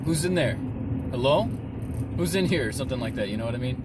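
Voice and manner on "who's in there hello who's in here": calling out. Acted. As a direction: call into the dark to find who's there. Startled man calls into the dark.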